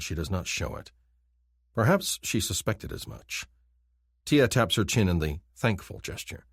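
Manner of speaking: ordinary delivery, nothing marked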